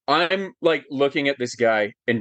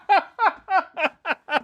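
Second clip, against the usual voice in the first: delighted laugh. Retold or imitated. Fan laughing in joy recalling the hug. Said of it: "he gives me".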